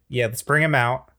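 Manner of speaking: normal talking voice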